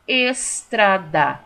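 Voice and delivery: ESTRADA very slowly in three beats, es-tra-da; syllable by syllable. Shown as a demonstration. Teacher shows pupils a trissílaba, estrada syllable by syllable. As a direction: stretch estrada out piece by piece. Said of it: "Trissílaba".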